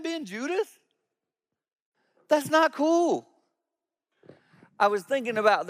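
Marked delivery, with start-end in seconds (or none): 2.3-3.3 high, slow, drawn-out disapproval; slow emphatic. Real, not acted. Pastor picturing Judas handed the bread, slow and high emphasis. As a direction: let each word land slowly.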